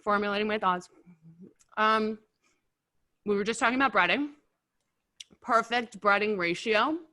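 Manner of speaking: talking through a mouthful of wings; chewing while speaking; mouth full. Real, not acted. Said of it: "Talking with my mouth full".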